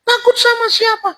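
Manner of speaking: very high falsetto woman; high put-on female voice; woman's voice. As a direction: pitch way up as the woman answering. Voicing the woman in the story.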